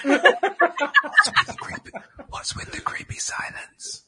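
whispered, creepy and hushed. Pretend. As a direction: breathe it like a spooky silence. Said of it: "the audio's gonna be like".

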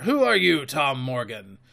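punchy, emphatic pirate threat; forceful old sea dog laying down the law; Silver, menacing captain. Acted. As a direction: put a mutineer in his place.

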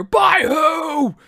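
high, loud suspicious bark; challenging shout. Retold or imitated. Sergeant major doubting the soldier's story, loud shout. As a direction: snap the question back at him. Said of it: "sergeant major challenging him".

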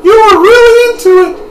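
very high, loud, quick falsetto; excited girl character. Acted. Girl watching Dart play the game, high excited falsetto. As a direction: squeal it high and fast.

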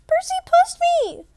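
tattling scream at full volume; girl screaming. Acted. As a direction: shriek it like a bratty girl caught out.

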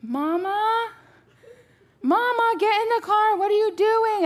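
high-pitched imitation of a small child; three-year-old son. Son calling mama back to the car, imitated child voice. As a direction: call out like a worried little kid. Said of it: "he's three, he's like".

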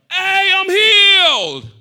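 very loud, high, slow joyful shout; healed leper shouting his thanks. Retold or imitated. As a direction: shout it out with all your might. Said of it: "with a loud voice".

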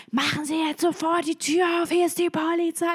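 hoher, nachgespielter Polizeibefehl; fordernder Polizist. Retold or imitated. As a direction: den Polizisten vor der Tür nachmachen. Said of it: "knallt wieder mit Volllauch gegen die Tür". (nachgeahmter Polizeibefehl)

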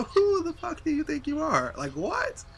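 high, slow, incredulous scolding; mock scolding. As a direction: drag it out high and disbelieving. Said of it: "Like, you know".

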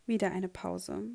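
ordinary delivery

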